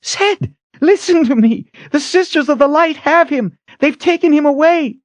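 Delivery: high, loud desperate alarm; frantic. A narrator's reading. Kalen telling Zed of Richard's capture, frantic. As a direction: pour out the alarm at high pitch. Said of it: "Kalen was frantic".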